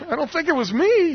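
high, loud, comic protest; high-pitched disclaimer. Retold or imitated. Helper answering the healed old man, high comic protest. Retold reply to Bob's question.

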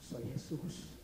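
very soft, low and hushed; a quiet, tender call through glass; voice of God. Acted. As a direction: say it low and gently, with pauses. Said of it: "la voz de Dios penetraba el cristal".

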